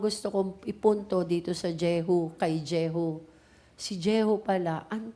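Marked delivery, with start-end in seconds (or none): none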